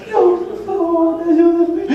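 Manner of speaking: high, slow, desperate begging; pleading girl's voice. Retold or imitated. Preacher voicing the possessed girl's plea, high and slow. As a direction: plead like a frightened child. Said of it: "habla con una voz de ultratumba".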